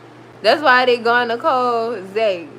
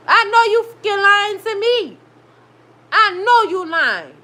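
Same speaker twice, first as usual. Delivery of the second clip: pissed-off disbelief; 6 semitones higher than usual. Real, not acted. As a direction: snap back at the lie. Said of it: "That really pissed me off".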